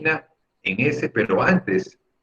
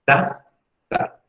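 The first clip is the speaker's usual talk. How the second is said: ordinary delivery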